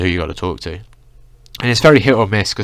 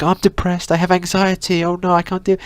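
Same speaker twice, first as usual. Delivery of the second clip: much higher, whiny mock teen voice; hammed-up impression of a teenage girl's lament; overdramatic teenage girl; 10 semitones higher than usual. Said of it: "overdramatic teenage girls who are gonna come to them".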